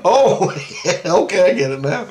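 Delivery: laughing realization. Retold or imitated. Realizing the joke in his dream, laughing. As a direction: get it while laughing.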